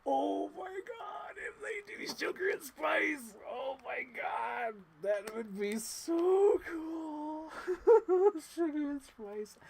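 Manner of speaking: high, slow squealing with delight; excited squeal. Real, not acted. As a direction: squeal like a thrilled fan. Fan squealing over dream toy.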